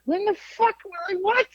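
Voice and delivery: high-pitched incredulous squawk; mock outrage. Parent scoffing at Nerf gun panic, mock outrage.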